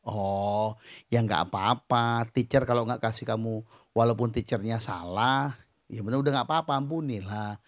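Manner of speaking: low, soft reassuring voice; comforting father. Retold or imitated. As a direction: gently talk a child out of sulking. Father comforting upset child, retold. (menenangkan anak dengan lembut)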